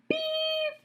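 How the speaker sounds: high flat electronic beep; be the lie detector going off; machine beep. A narrator's reading. Lie detector catching the boy's lie, high flat beep.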